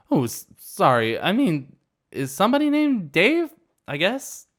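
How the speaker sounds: put-on high, loud, wobbly drunk voice; drunk girl. Drunk girl correcting herself, high and loud. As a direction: fumble it like a tipsy girl.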